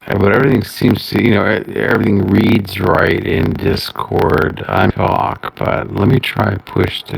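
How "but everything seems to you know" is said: usual speaking voice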